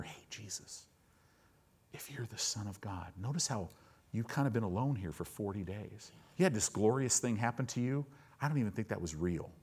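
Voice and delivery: hushed whisper easing into a soft murmur. Acted. Satan whispering doubt in Jesus' ear, whisper. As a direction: slip it softly into his ear. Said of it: "he'll whisper in your ear".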